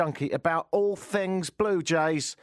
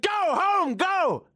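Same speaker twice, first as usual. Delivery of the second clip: high, louder mock shout from the window; shouting back; 4 dB louder, slower than usual. Shouting at the fan from the window, high mock yell.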